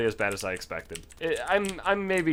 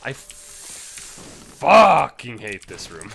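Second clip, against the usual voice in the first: frustrated growl; 9 dB louder than usual. Real, not acted. Venting at a hated room.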